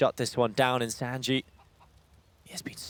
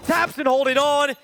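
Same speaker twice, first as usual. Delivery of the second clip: excited shouting; 8 semitones higher than usual. Real, not acted. Caster calling a clutch round.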